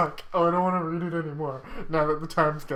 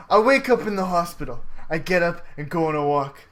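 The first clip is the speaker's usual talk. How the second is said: ordinary delivery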